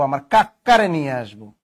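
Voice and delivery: insist, pressing on a double k in কাকারে; doubled consonant. Acted. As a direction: hold the middle k of কাকারে. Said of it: "কাকা হয়ে গেল কি?".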